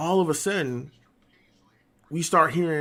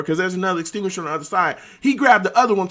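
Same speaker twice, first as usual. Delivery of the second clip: usual speaking voice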